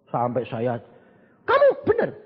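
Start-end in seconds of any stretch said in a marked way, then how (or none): 1.4-2.1 high, louder startled check; disbelieving. Retold or imitated. Pastor doubting the report, high disbelieving question. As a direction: ask as if you can't believe it.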